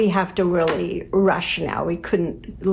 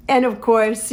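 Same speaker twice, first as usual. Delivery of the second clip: ordinary delivery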